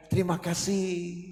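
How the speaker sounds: soft, slow, gracious thank you; sweet polite thanks. Retold or imitated. Husband thanking the café waitress, soft and gracious. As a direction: thank her sweetly and slowly.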